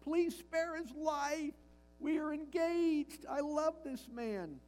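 put-on high, slow, imploring plea of a young girl; a young fiancée begging Cromwell to spare her man, pleading. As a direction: beg for his life, lightly voiced. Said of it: "she pleaded with Cromwell".

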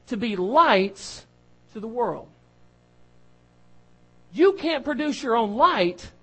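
slow, loud emphatic preaching; preacher slowing and pounding the point; fervent preaching. Acted. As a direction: press each phrase on the church. Preaching at full force.

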